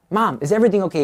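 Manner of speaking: acted rising panic, calling to her; starting to freak out. Son fearing for his weeping mother, acted alarm. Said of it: "He's starting to freak out".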